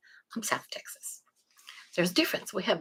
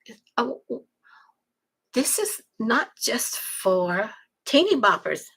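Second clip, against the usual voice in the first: surprised realization; 5 semitones higher, slower than usual. Pretend. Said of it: "It's kinda like".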